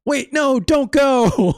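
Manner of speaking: high, loud whiny plea; mock pleading. Player mocking Mario begging the wrestler to stay. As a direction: beg him not to leave, hammed up. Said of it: "Mario here is like".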